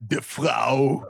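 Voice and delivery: Austrian accent, put on for the language app; Schwarzenegger accent. Faking an Austrian accent so the app understands, lightly done. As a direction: pump up a fake Arnold Austrian accent. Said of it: "fake a Arnold Schwarzenegger accent".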